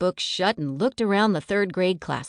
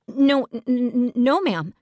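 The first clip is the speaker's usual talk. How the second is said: high, louder scared stammer; stuttering; 7 semitones higher, 4 dB louder than usual. Acted. Girl caught by the teacher, scared stammering. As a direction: trip over the no, then settle. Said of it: "Liza stuttered".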